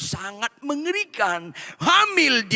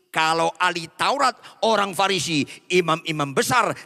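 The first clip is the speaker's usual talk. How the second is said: usual speaking voice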